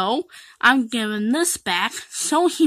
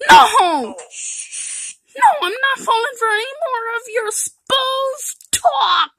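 indignant outburst; 8 semitones higher than usual. Acted. Refuses friend's scheme.

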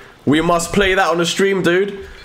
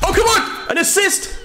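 high-pitched exasperated protest. Real, not acted. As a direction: cry out in frustration at the game. Frustrated reaction to game.

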